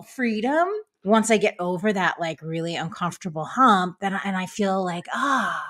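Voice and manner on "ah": usual speaking voice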